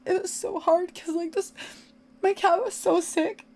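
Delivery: high, tearful voice; crying. Real, not acted. Owner recalling how sick the cat was, crying. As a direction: strain upward through real grief. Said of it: "I'm crying 'cause of".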